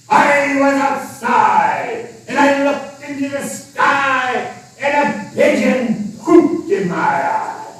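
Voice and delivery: high, slow shouting pirate. Acted. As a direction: yell it like an outraged pirate.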